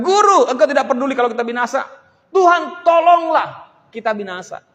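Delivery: desperate shout. Acted. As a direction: shout it like men about to drown. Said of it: "Mereka katakan".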